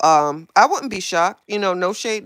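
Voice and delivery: usual speaking voice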